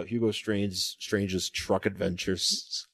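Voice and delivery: slow, drawn-out title; slow drawl. Pretend. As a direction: stretch the title out slowly. Mock title said slowly.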